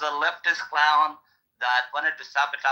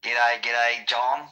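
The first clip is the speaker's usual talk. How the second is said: put-on Aussie accent; 5 semitones lower, slower than usual.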